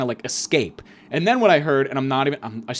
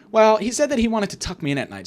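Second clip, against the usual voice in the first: high put-on daughter voice; mock girlish high delivery; Tony's daughter; 8 semitones higher than usual. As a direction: play the daughter reporting it, pitched up. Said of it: "she's like".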